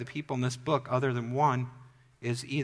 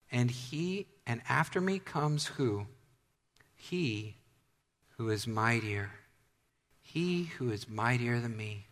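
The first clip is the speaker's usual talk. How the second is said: soft, lowered, spaced-out reading; hushed scripture reading; 3 semitones lower, 5 dB quieter, slower than usual. Acted. Preacher reading a verse to the congregation, hushed and deliberate. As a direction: read the verse softly, with weighty pauses.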